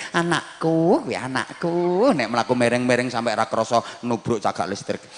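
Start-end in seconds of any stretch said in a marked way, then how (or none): 0.1-2.1 higher, gushing proud-mother voice. Retold or imitated. Mother brags to the neighbours about her son, gushing and higher. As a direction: crow over your child.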